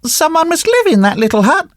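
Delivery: high-pitched little puppet voice; puppet boy. Acted. Puppet boy spots a cottage, high little voice. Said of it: "said Pinocchio".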